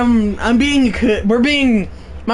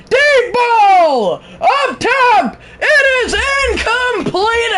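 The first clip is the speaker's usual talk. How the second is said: live play-by-play; 8 semitones higher, slower than usual. Acted. Streamer calling the Alabama drive to chat, excited. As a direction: build the call to a yell as the pass sails incomplete.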